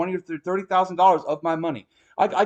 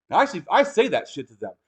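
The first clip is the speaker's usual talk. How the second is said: ordinary delivery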